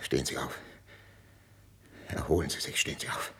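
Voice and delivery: hushed, low and spent; near-whispered command; subdued urging. Acted. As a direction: urge quietly after the outburst. King after queen collapses.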